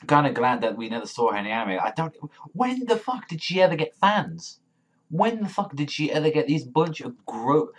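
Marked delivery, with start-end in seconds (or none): 2.5-7.7 high-pitched, indignant disbelief; incredulous outrage. Real, not acted. Fan outraged that she ever got fans, incredulous. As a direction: demand an answer, pitch shooting up.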